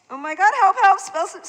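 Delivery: loud, high pretend cry for help; mock panic. Child playing space invaders, hammed-up panic. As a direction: cry out like a kid playing scared.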